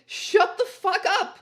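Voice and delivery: high, loud, slow bellow; furious shout. Acted. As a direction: bark it at the class, slowly. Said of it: "shooted Cornelia Fuck".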